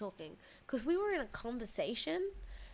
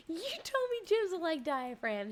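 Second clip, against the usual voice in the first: higher, louder, protesting she is right; indignant insistence; 7 semitones higher, 3 dB louder than usual. Retold or imitated. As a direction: insist hard, almost indignant. Arguing back to partner.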